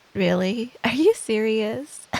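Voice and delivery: ordinary delivery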